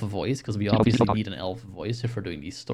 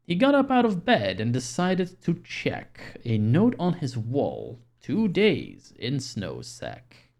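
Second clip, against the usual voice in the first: rhyming story reading; 4 semitones higher, slower than usual. Acted. Reads a rhyming Christmas story.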